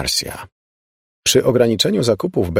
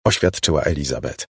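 ordinary delivery, nothing marked

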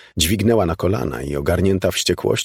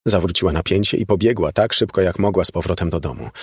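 ordinary delivery, nothing marked

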